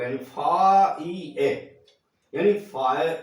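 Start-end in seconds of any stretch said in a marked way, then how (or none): 0.2-1.5 "फा ई ए" drawn out very slowly, each syllable separate; fire split into syllables. Shown as a demonstration. Teacher breaking fire into its sounds for children, slow syllables. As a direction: spell out "फा ई ए" slowly, one sound at a time. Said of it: "वो आ ई ए के रूप में होगा".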